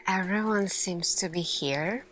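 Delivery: ordinary delivery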